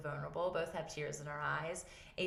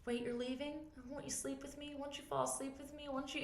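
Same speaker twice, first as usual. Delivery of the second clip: put-on higher, softer little-kid plea; pleading child; 9 semitones higher, 7 dB quieter than usual. Son asking mom to stay at bedtime, soft high pleading. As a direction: beg softly like a sleepy child. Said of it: "he's like".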